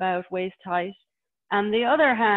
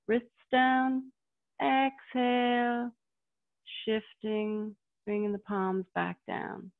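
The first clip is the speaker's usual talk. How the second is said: serene tai chi cues; 3 semitones higher, 3 dB quieter, slower than usual. Shown as a demonstration. Tai chi instructor guiding slow breath.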